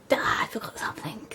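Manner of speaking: airy, mock-exasperated half-whisper; mock forgetfulness. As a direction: let out an airy 'duh' at yourself. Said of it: "and be like".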